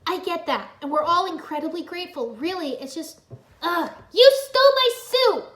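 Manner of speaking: building from high and tense to a loud yell; exasperated shout. A narrator's reading. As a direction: boil over at the end. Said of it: "Nia shouted at Samurai X".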